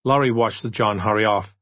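ordinary delivery, nothing marked

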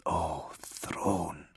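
low, soft horrified oath; whisper. A narrator's reading. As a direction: whisper it under your breath. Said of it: "Kalia whispered".

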